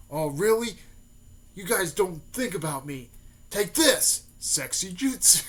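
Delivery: defiant attack call. Retold or imitated. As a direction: act out a cocky anime move shout. Naruto defiantly calls out his attack.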